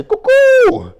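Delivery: very high, loud, slow squeal; cuckoo signal. Retold or imitated. Signalling the guards with a shrill slow cry. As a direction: shriek the code word. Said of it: "I'd be like".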